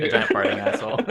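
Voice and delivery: laughing through the words; can barely get it out for laughing. Real, not acted. Players laughing at crude joke.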